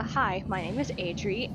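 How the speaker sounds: ordinary talk, nothing marked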